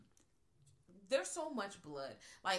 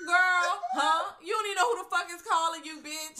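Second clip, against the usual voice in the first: mock confrontation; 10 semitones higher, 13 dB louder than usual.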